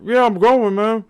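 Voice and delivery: put-on high, slurred drunk mumble; drunk babbling. Drunk guy answering the frat brother in slurred babble. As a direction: babble like a wasted guy barely making words. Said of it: "it wasn't really English, honestly".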